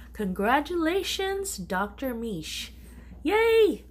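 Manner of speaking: loud, high winner announcement; big giveaway congratulations, slow and loud; excited announcement. Real, not acted. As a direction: cheer the winner's name out.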